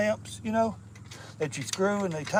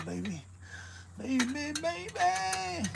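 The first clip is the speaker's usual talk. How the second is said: coaxing; 10 semitones higher, 8 dB quieter, slower than usual. Real, not acted. Coaxing a stuck nut, pleading higher. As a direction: sweet-talk the stubborn bolt in a rising croon.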